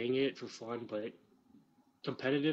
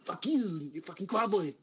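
higher, louder hammed-up Austrian accent; Arnold impression; 5 semitones higher, 3 dB louder than usual. Arnold insulting Sarah Connor, hammed-up Austrian accent. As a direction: snap back as cartoon Arnold. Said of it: "I was like".

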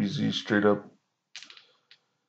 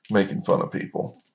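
ordinary talk

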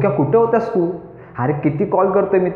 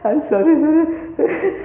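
giggly apology; 11 semitones higher, much slower than usual. Real, not acted. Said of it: "हसतोस तू".

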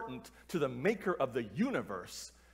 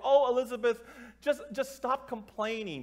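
higher, louder mock-teacher brush-off; dismissive teacher; 7 semitones higher, 4 dB louder than usual. Teachers brushing off a student who asked for help, dismissive. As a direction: imitate a teacher waving away a complaint.

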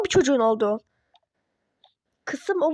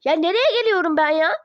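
ordinary talk, nothing marked

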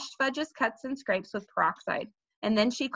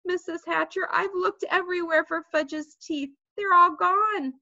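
mildly sniffly; 7 semitones higher than usual. A narrator's reading. Sheila reports failure to Missus Hatcher, mildly sniffly. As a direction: confess it plaintively, building to the end. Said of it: "Sheila sniffled and said".

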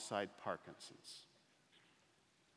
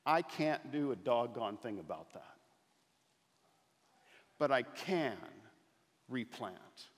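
louder, higher rhetorical questions answered with a firm "No"; preaching at full force; 5 semitones higher, 7 dB louder than usual. Acted. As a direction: build to a rousing preacher's climax.

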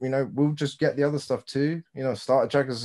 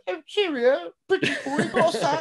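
much higher, laughing mock announcer; a smug announcer's high, laughing jab; mocking commentator. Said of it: "then it's like".

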